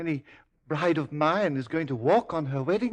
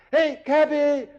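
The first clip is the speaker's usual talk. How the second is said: loud, high hail across the street; calling out; 7 semitones higher, 8 dB louder, slower than usual. Acted. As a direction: flag down a passing cab. Hailing a cab in the street.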